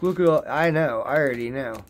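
ordinary delivery

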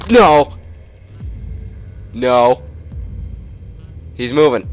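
loud frightened shout; scared shout. Real, not acted. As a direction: yell no in panic. Monster moving.

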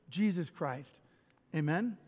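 ordinary talk

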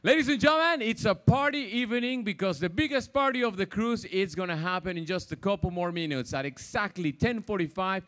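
loud, upbeat party announcement; upbeat cruise director. Real, not acted. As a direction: pump up the audience for the party.

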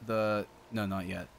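normal talking voice

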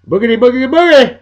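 whooping NASCAR call; race-start catchphrase. Retold or imitated. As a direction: belt it like the green flag just dropped. Darrell Waltrip's race-start catchphrase.

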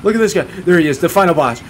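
high, loud excited call; loud pitch-high outburst; excited shout. Real, not acted. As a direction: hail the final boss. Spots a boss in game.

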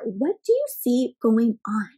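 ordinary delivery

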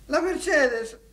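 high, loud declaration of lost love; anguished lament. Acted. Man explains Mercedes is his woman, anguished. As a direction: cry it out, lovesick.

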